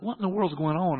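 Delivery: high, bewildered alarm; retold alarm. Son watching oxygen levels drop, alarmed disbelief. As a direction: retell it in rising panic. Retold panic as nobody answers.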